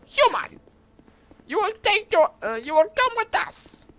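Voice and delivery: high-pitched, screechy cartoon villain; Papyrus. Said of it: "see if I can do papyrus".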